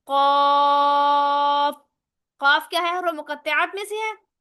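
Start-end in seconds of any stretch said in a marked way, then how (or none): 0.1-1.7 قاف drawn out long in Quranic recitation; recited Quran letter. Shown as a demonstration. Teacher shows her students a tajweed example, long recited قاف. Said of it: "جیسے".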